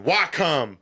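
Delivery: mock Southern drawl on why come; a lightly played Southern country boy; Southern response. A brother answering dad in mock Southern style. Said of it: "in the true Southern response".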